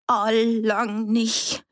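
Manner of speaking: high, slow, trembling refusal; strained, quivering dialect voice. A narrator's reading. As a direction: draw it out with a quaver. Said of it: "Annis Stimme zitterte".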